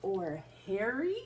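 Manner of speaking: lively, expressive picture-book read-aloud; reading to children. Acted. Teacher reading a picture book to young children, expressive. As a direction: read it warmly to a circle of kids.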